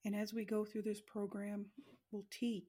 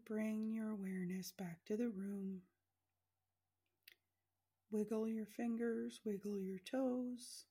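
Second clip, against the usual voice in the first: guided meditation. Acted. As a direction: guide them gently into relaxing.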